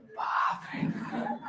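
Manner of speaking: laughing. Real, not acted. Students' laughing shout.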